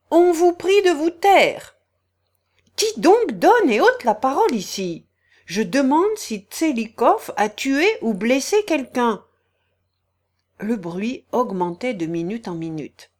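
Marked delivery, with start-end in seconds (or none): 0.1-9.2 heated shouting. Acted. Heated quarrel in the assembly.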